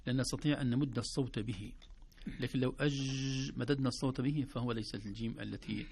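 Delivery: ordinary talk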